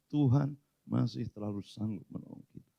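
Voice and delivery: ordinary delivery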